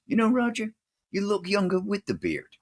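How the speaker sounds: high-pitched quote of a captain; lightly acted, high-voiced compliment; retold Captain Murphy. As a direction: lift the voice high to quote him. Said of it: "Captain Murphy walks up and says".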